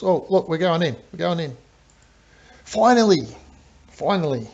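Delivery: high, excited burst peaking on the first "Finally". Real, not acted. Game commentator seeing the attack finally go in, excited.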